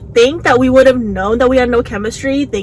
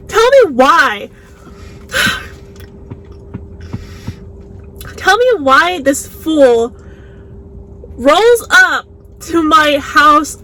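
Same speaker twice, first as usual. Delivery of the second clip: slow, drawn-out incredulous complaint, pitched up; dramatic exasperation; 4 semitones higher, much slower than usual. Real, not acted. Telling viewers about the date at the door, exasperated. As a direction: stretch each phrase out in exasperated disbelief. Exasperated storytelling build-up to the outrage.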